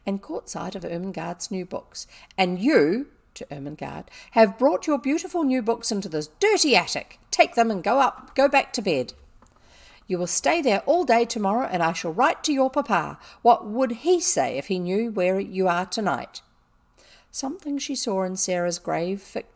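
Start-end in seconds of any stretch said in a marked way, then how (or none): 2.4-3.1 loud, accusing call; angry address. A narrator's reading. Turns angrily on Ermengarde. 4.3-16.4 loud, indignant tirade; angry scolding. A narrator's reading.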